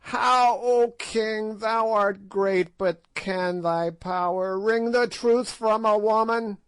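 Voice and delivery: high crone voice, defiant and mocking; ancient witch. A narrator's reading. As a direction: play a spiteful ancient witch, gloating. Old witch Gagool voiced.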